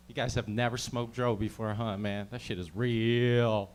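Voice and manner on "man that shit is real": ordinary delivery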